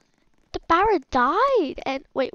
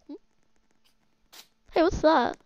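ordinary delivery

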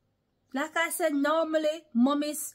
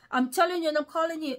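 normal talking voice

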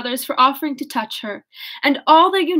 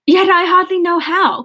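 cried out; 7 semitones higher, 5 dB louder than usual. A narrator's reading. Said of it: "cried Marianne".

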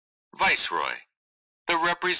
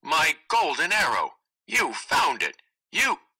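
higher, brighter burst of joy over the arrow; joyous; 7 semitones higher than usual. Acted. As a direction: cry out the find with delight.

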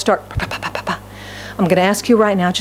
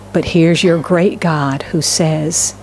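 ordinary talk, nothing marked

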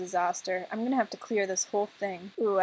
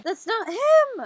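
high, slow wail; drawn-out dramatic cry of protest; mock outraged protest; 12 semitones higher, 4 dB louder, slower than usual. Reads out players' protests dramatically.

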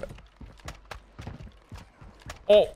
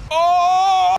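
shocked yell; 5 dB louder than usual. Real, not acted. Shocked by new enemy.

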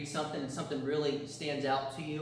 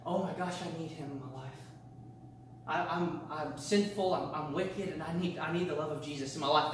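a hint of a sinner realizing need; voiced sinner's realization. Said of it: "we see".

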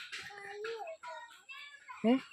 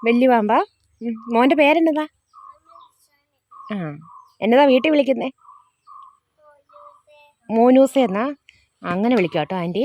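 calling out; 5 semitones higher, 5 dB louder than usual. Real, not acted. Calling out to visiting kids.

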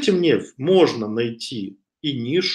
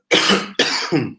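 a short real cough; 3 semitones higher, 3 dB louder than usual. Real, not acted. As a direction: cough into the mic, then carry on. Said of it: "Я прошу прощения за кашель". (короткий кашель)

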